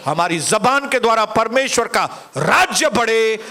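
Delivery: ordinary talk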